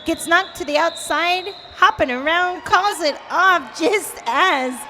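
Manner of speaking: high, loud play-by-play call; excited play-by-play. Real, not acted. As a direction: call the action with rising excitement.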